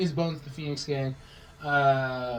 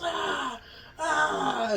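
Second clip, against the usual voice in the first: high mock scream of pain; high-pitched "ah" of pretend agony; 12 semitones higher than usual. Retold kid screaming in pain.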